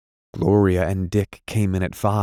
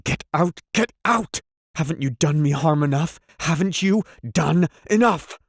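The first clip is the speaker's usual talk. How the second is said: very high, frantic screams to leave; screaming her out of the room; man shrieks at the woman to go; shrieking; 9 semitones higher than usual. A narrator's reading. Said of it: "he almost shrieked".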